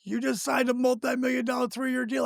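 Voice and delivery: imitating a stunned wife; shocked wife. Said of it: "She's like".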